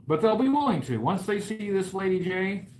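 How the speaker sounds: usual speaking voice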